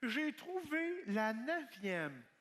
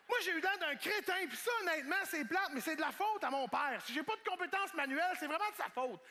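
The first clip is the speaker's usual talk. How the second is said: ordinary talk, nothing marked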